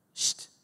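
sharp voiceless "shh"; shushing hiss. Acted. Bystander silencing the blind man's calls, shushing. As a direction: hush someone who is shouting.

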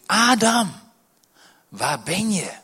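high ringing call to Adam; God calling out. Acted. God calling Adam from afar. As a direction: call out across the garden, high and loud.

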